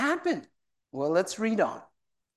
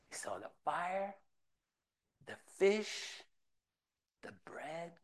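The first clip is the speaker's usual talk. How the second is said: softer and softer, down to a whisper; hushed awe; 14 dB quieter than usual. Acted. Preacher paints the beach scene, fading into a whisper. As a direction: let the wonder hush you.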